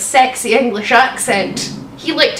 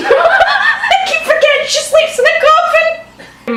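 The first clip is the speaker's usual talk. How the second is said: high laughing disbelief; laughing through the words. Real, not acted. Laughing aside.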